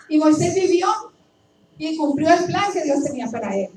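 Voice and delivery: rising pulpit fervor; preaching. Acted. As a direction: proclaim it to the congregation. Preaching at full force.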